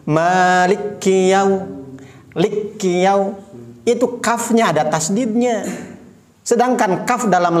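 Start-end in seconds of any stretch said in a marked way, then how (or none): 0.1-3.3 Maliki with the kaf wrongly doubled; Maliki Yaum- misread with a doubled kaf, slowly; wrong reading. Shown as a demonstration. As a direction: imitate a learner holding the kaf in Maliki. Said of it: "Ada yang bacaannya seperti ini".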